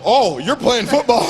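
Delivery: high, drawn-out mock excitement; amazed coach. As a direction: imitate a coach thrilled by a discovery. Coach amazed at his running speed.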